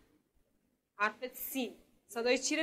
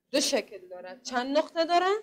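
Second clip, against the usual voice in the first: ordinary delivery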